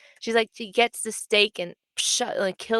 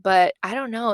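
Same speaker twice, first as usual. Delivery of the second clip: ordinary delivery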